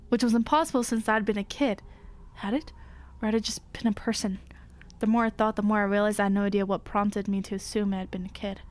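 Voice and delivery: low, hushed horror storytelling; story narration. A narrator's reading. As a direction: read it low and quiet, drawing us in. Reading horror story aloud.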